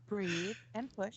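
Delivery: soft, slow labor coach; counting contractions. Pretend. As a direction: coax her gently through the push. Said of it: "You sound like a labor coach".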